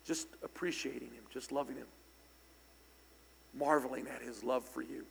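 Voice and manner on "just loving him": ordinary talk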